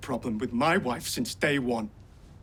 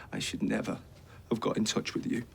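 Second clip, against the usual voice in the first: quiet hurt; 5 semitones lower, 7 dB quieter than usual. Acted. Son disowning his mother.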